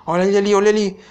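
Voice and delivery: high urgent calling out; excited. Real, not acted. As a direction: point it out urgently. Spotting monster, excited.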